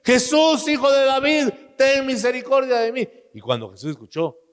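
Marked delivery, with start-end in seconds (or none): 0.0-3.1 high, loud pleading shout; desperate crying out. Retold or imitated. Blind Bartimeo crying out to Jesus, loud high plea. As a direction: cry out to Jesus as the blind man. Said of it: "y comenzó a gritar".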